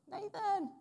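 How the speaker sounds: very high-pitched schoolboy shout; year seven voice. Retold or imitated. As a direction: call out to a friend in a squeaky schoolkid voice. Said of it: "little, you know, year seven voice".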